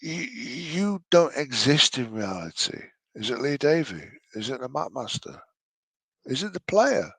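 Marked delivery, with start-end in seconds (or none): none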